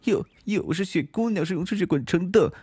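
put-on high, rapid storybook girl; tearful Snow Maiden. Snow Maiden telling the wolf her woes, high plaintive rush. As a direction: pour it out in a high, hurried little voice.

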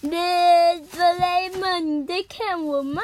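loud, high, slow calling out; excited kid shout. Acted. Nobita calling to Doraemon, loud and high. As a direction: call out like a kid showing off.

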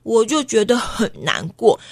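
plain reading voice

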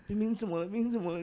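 playing the fans fussing over her; worried fan. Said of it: "然后粉丝很担心，说".

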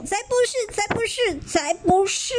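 put-on high childish angry protest; a hint of shouting back; mock roar. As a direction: siblings angrily protesting Xiaolu, mild mock roar. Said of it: "怒吼".

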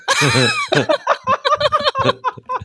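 long high burst of laughter; real laugh. Real, not acted. Laughing at her own pad story, a long high laugh. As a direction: break up laughing at the memory.

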